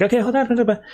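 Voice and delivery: lightly hammed-up pleading for a moment; mock urgent stalling.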